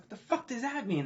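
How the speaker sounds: shocked disbelief. Retold or imitated. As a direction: blurt it out in sudden disbelief. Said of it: "I'm like".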